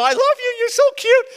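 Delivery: put-on strained baby talk. Tired father forcing high baby talk at crying baby.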